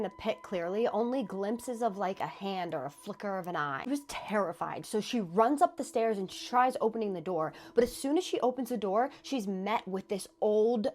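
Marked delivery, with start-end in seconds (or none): none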